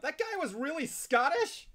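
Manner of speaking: high, loud, slow disbelieving outburst; burst of disbelief. Retold or imitated. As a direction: blurt it out, stunned and drawn out. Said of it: "New bursts out".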